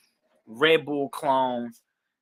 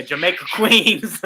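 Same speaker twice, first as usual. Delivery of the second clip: laughing punchline; 5 semitones higher, 7 dB louder, slower than usual. Retold or imitated. Punchline of his own joke.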